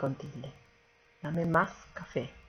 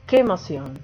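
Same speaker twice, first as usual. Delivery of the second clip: emphatic exclamation example; 8 dB louder than usual. Shown as a demonstration. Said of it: "o el enfático".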